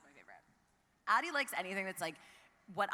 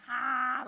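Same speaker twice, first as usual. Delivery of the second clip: long, loud, higher whiny "ah"; whining groan; 5 semitones higher, 7 dB louder than usual. Retold or imitated. Reminding a friend of the whining cartoon character, imitated whiny groan. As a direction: imitate a drawn-out whine. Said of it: "the whining?".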